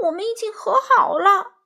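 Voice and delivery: high, loud frightened assurance; scared pleading. A narrator's reading. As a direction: plead with the ghost that it's over. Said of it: "欢仔结结巴巴地说".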